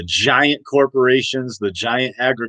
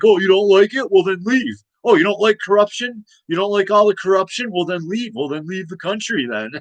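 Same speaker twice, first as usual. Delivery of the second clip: higher, sneering mock voice; imitating dismissive Americans; 6 semitones higher than usual. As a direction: brush off the complaint with scorn. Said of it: "Americans are like".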